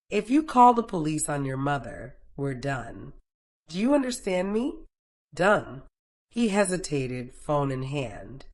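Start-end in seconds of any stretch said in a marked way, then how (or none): none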